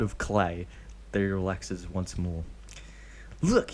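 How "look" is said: put-on high excited kid voice; young boy voice. A narrator's reading. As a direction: call it out like an eager boy. Kid shows clay.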